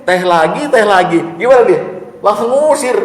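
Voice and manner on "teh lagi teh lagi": high, loud fed-up whine; annoyed complaint. Retold or imitated. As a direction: complain about the unsweet tea. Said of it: "Kita bilang gini". (keluhan kesal, tinggi dan keras)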